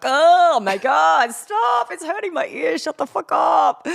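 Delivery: high, loud, drawn-out disgust building to a yell; mock outraged shout. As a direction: pretend the accent hurts your ears and shout them down. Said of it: "I'm like".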